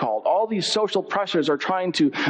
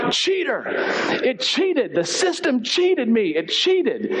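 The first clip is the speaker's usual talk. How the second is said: hammed-up indignant gamer; mock shouting; 6 semitones higher, slower than usual. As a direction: accuse it in mock outrage, pitched high. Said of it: "The typical response was to start shouting".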